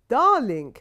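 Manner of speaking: put-on loud, grand old lady calling from the doorway; a matriarch claiming the room; commanding grandmother. A narrator's reading. Grandmother Rogie calls from doorway.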